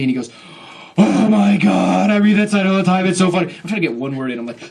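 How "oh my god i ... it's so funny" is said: loud, high gushing fan yell; mock a hugely loud, unstoppable enthusiast; imitated yelling. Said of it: "He yelled at me".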